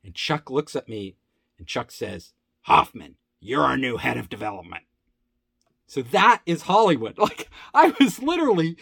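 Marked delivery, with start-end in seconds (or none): 2.6-4.8 gruff boss. Retold or imitated. Company head to employee announcing promotion, gruff and low. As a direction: imitate a boss giving a blunt verdict.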